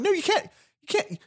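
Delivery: high-pitched protest; hammed-up movie-dialogue objection; acted exasperated refusal. Acts out exasperated film dialogue.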